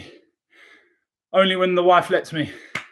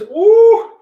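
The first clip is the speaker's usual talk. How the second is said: hoarse marathon cheering; 14 semitones higher, 9 dB louder than usual. Real, not acted. Says "this is burning" right after.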